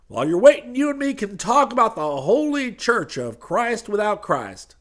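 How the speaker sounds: loud, high glad-handing preacher voice; high preacher figure. Acted. Street preacher pitching to the crowd, loud and high. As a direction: boom it out like a street preacher selling salvation.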